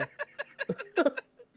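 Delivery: very high burst of laughter; real tearful cackle; laughing to tears. Real, not acted. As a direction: laugh helplessly, high-pitched. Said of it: "I got tears coming out".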